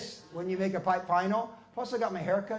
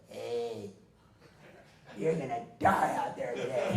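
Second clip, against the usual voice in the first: low, lazy taunt; Roy imitation; 8 semitones lower, slower than usual. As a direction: lean in and threaten lightly. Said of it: "gets out of the car like".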